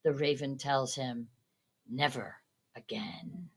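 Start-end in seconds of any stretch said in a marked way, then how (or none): 1.9-3.5 soft, slow and low; quiet verdict. A narrator's reading. Raven answering the man, soft, low and slow. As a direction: let the raven's words sink, slowly.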